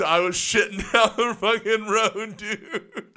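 high-pitched, laughing through the words; laughing punchline. Real, not acted. Storyteller admitting his roadside mess to the host, laughing. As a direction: crack up while confessing it.

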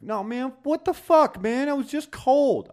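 very high, loud whiny protest; whiny Jamie. Retold or imitated. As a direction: whine like a caught thief. Imitating Jamie whining.

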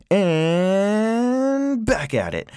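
high, very drawn-out "and"; singsong return to the topic; goofy segue. Real, not acted. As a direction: stretch it out like a comic wind-up.

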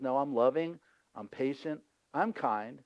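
gentle God voice. Acted. God answers Abraham gently.

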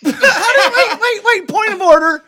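high, loud laughing protest; cracking up while objecting; laughing disbelief. Real, not acted. Incredulous at friend's claim.

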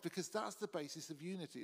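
normal talking voice

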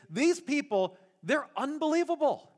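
God at the end of his patience, venting; God fed up with his ungrateful people; exasperated God. Retold or imitated. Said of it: "What God was essentially saying here is".

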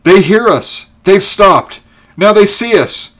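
high, loud, elated shouting; excited cry. A narrator's reading. Ulrich seeing figures running down the hill, excited cry. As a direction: shout each new sighting out, pitched right up. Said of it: "cried Ulrich".

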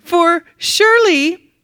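slow, high sarcastic taunt; mocking. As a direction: drag out the fake sympathy. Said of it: "Elijah began mocking them".

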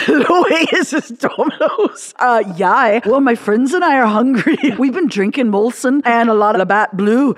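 laughing, hammed-up Canadian accent; mock Canadian. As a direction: play a tipsy Canadian caller ordering pizza, cracking up. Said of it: "We don't go".

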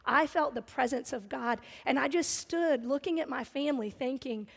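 usual speaking voice

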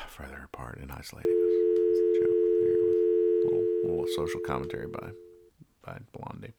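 soft, low deflated mumble. Real, not acted. Host explaining a flat comic joke, deflated. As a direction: let the explanation sag away.

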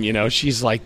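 usual speaking voice

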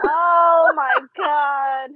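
long, drawn-out, loud exclamation; exasperated outburst. Real, not acted. Co-host appalled that her friend quit watching, exasperated. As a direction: drag it out loudly in disbelief.

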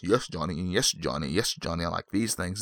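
soft, hushed fan nodding along; mock viewer agreeing. Fan agreeing with Johnny, hushed and soft. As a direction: murmur it like a fan ticking boxes.

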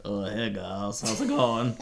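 low, deep male voice on radio; Fredericks voice. Acted. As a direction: drop low as Fredericks on walkie talkie. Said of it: "I have his voice".

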